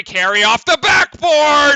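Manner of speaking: high, loud, stretched call; excited shout. Real, not acted. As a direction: yell it as the ball banks in. Calling a made basket.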